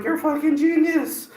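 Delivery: excited, high-pitched retold compliment; impressed praise. Man praising a body painter, excited amazement. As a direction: marvel at it, pitch way up.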